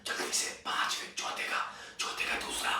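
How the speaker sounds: hushed whisper asking for answers; student whispering to a classmate for an answer. Retold or imitated. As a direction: whisper urgently across the desk. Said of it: "Munni सब कह रहे".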